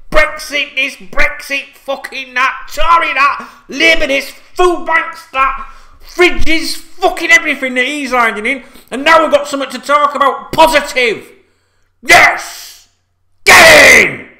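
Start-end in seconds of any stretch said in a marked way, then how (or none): 0.1-8.6 higher, louder mocking list; fed-up fan ranting at politics; exasperated rant. Real, not acted. Ranting about political arguments. 12.0-14.3 very loud high yell; real fan celebrating the news; shouting. Real, not acted. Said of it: "When I start shouting like that".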